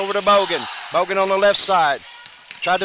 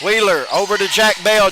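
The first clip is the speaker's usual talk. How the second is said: ordinary talk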